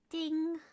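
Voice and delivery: high sung ding like a phone alert; a little chime imitation; imitated ding. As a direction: mimic the app notification. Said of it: "It was like the WhatsApp sound".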